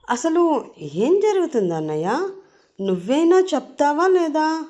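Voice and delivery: high, swooping exasperated questions; baffled demand. Acted. Confused sister demanding that her brother explain, exasperated. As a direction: press for an answer, pitch climbing high.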